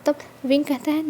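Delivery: ordinary delivery, nothing marked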